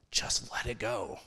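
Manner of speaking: just let it go, breathed without voice; whispered. Acted. Preacher whispering the first step to his listeners. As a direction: whisper it to the congregation like a secret. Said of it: "Number one".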